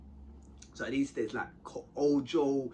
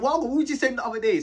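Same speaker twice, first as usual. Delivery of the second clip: raised, challenging question; confrontational; 6 semitones higher, 6 dB louder than usual. Retold or imitated. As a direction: call him out loudly. Quoting Bandit confronting Boxer.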